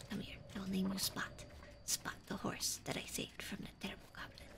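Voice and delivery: soft hushed whisper to a skittish animal; gentle whisper so the horse won't bolt. Acted.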